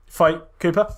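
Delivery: ordinary talk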